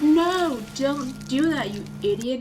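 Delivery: mock outrage, loud on the no; mock angry scolding. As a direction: scold a friend who ignored the memo. Ivy scolds Val in retold scene.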